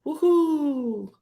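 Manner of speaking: sarcastic high woohoo; mock cheer. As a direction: fake excitement, squeaked high. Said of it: "Super excited".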